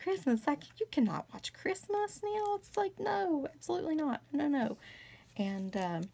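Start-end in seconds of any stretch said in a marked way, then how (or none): 0.0-4.8 high, outraged disbelief at watching Christmas movies; aghast, mock-horrified refusal; incredulous outburst. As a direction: squeal it like the idea is absurd. She scoffs at her uncle's movie.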